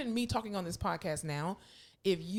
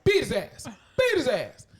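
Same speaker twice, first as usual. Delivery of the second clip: loud, raised mock holler; background heckling; 6 semitones higher, 10 dB louder than usual. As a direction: egg them on from across the room.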